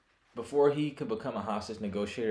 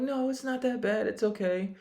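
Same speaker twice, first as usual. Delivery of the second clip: much higher, sing-song brush-off; mock forgiving voice; 13 semitones higher than usual. Forgiving the apologizer, squeaky high mock reassurance. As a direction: play the friend waving off an apology in a squeaky high voice.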